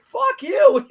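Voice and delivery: high, loud mock outburst; mock angry retort. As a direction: snap it back in a hammed-up outrage.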